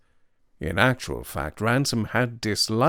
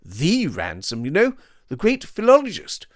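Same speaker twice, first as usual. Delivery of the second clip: languid upper-class gent; 10 semitones higher than usual. Acted. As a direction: play him smug and breezy. Devine's voice held.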